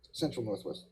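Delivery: ordinary talk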